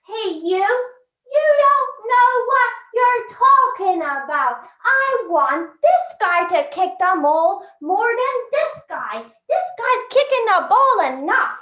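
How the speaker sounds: high, heated mock shouting; shouting players. Players shouting back from the pitch, heated mock yelling. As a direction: yell back, pitch leaping up on key phrases. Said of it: "they start shouting".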